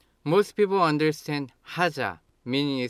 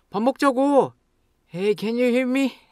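high, loud demand; angry; 12 semitones higher, 7 dB louder, much faster than usual. Shown as a demonstration. To a friend who won't come eat, angry. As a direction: shout in exasperation. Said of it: "Then you get angry and say".

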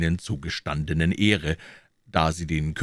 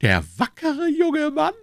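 admiring king; 5 dB louder than usual. A narrator's reading. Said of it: "murmelte der König".

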